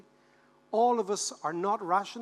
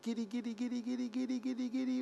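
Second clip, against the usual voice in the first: quick high chant, building upward; rising giddy babble; 4 semitones higher, 7 dB quieter than usual. Acted. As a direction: act out a crowd getting giddy. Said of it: "we all get giddy together".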